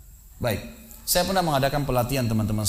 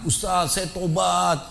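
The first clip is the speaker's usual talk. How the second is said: higher, pleading imitation of a penitent; trembling tearful confession; 5 semitones higher, slower than usual. Retelling a shaken volunteer confessing sins to the preacher, imitated tearful pleading. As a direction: imitate a weeping confession, pitched up and drawn out.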